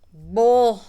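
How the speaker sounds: big loud holler; game-call yell. Shown as a demonstration. Player calling a bluff at the table, yelled. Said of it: "you don't have to yell out".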